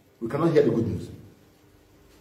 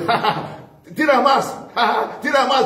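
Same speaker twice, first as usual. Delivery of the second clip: laughing defiance; 6 semitones higher than usual. Retold or imitated. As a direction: laugh it off and dare them to throw more. Stephen laughing while stoned.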